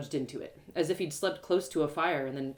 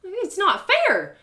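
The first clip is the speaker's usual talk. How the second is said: higher, louder, slow hurt complaint; hurt protest; 12 semitones higher, 8 dB louder, much slower than usual. Acted. Narrator complains to the beggar woman, hurt. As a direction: say it like the world wronged you.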